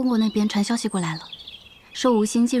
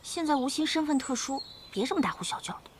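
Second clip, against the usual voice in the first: hushing; 4 semitones higher, 8 dB quieter than usual. Acted. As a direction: shush a noisy friend discreetly. Hushing a noisy friend.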